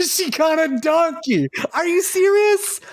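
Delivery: very high, laughing squeal of disbelief; high-pitched, cracking up; real laughing disbelief. Real, not acted. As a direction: shriek it through laughter, incredulous. Cracking up at a lyric.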